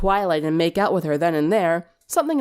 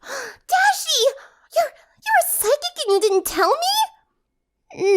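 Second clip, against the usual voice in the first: very high, astonished Pinkie; gasping amazement; slower than usual. A narrator's reading. Pinkie amazed at Rainbow, very high astonished squeal. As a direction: gasp it out in wonder. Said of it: "Pinkie gasped".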